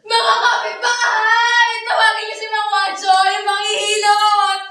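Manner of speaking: loud, high, hammed-up cries for help; panicked shouting. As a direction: call the neighbours in mock panic. Acted panic over dying Ben. (eksaheradong sigaw ng nagpapanik)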